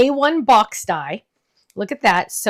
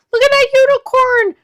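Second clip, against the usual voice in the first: excited squeal; 13 semitones higher, 7 dB louder than usual. Real, not acted. Gushing over a unicorn card.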